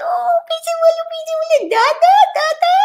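very high, fast sing-song coaxing; coaxing parent. Retold or imitated. Parents begging the baby to say it again, very high fast squeal. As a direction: urge the baby to repeat it, squealing. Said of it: "And they say".

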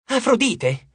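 high surprised cry of recognition; exclaimed. A narrator's reading. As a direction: call out the name in sudden surprise. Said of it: "esclamò". (esclamazione sorpresa)

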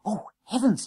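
high-pitched alarmed gasp. A narrator's reading. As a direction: catch your breath in dismay. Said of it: "he gasped".

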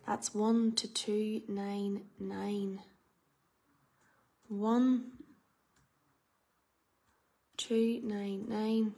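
slow drawn-out number call; bingo caller. Real, not acted. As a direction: stretch the numbers out. Calling the number range.

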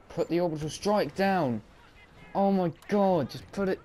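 high, loud exasperated cry; real frustration bursting out; frantic outburst. Real, not acted. Gamer yelling at his orbital strike that won't go down, frantic.

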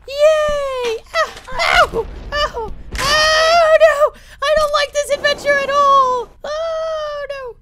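very high, loud falsetto wails; squealing little son. Pretend. Son hurt on the adventure, squealing falsetto wail. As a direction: cry out like a panicked squeaky kid. Voices Timmy screaming on adventure.

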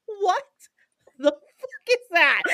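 high mock-outraged mother, on the edge of laughter; incredulous, drawn-out and high; pissed-off mom. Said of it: "his mom is pissed".

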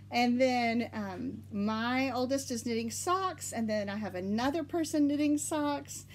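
normal talking voice

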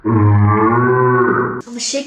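low, slow put-on voice; deep slow voice. Shaking jelly bean container.

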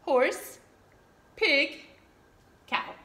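usual speaking voice

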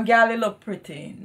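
"Gal, you look pretty" with Jamaican "Gal" and lilt; patois correction. Shown as a demonstration. Mother models the right word, Jamaican accent.

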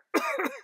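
very high hacking cough; smoggy cough. A narrator's reading. The Lorax chokes on smog, high cough. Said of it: "He coughed".

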